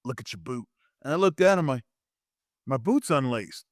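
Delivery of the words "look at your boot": low, hushed aside; quiet imitation of a secret whisper; retold whisper. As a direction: drop it down as if into someone's ear. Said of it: "he whispers in my ear".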